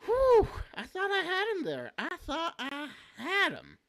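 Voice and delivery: high loud relieved whew; excited relief. Real, not acted. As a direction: blow out a big relieved breath. Near miss in game.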